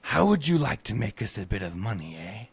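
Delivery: lightly voiced tipsy nobleman; a hint of a drunk lord scheming; drunken voice. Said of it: "whispering into his beard in a bounding, drunken voice".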